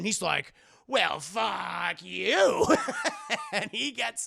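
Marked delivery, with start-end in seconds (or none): 0.9-2.7 very slow, loud drawn-out insult; exaggerated drawled comeback; mock retort. Comic slow retort in quarrel. 2.7-3.5 short high giggle; bright burst of laughter; amused laugh. Real, not acted. Laughing at own retort.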